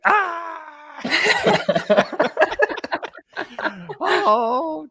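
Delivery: high mock shriek of panic. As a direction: shriek in comic alarm. Hearing Tim Kurkjian is there.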